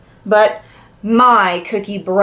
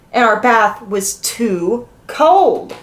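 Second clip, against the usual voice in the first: ordinary delivery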